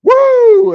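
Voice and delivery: loud, high "woo!"; celebratory whoop. Retold or imitated. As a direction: let out a triumphant whoop. Retold celebration after counting hits.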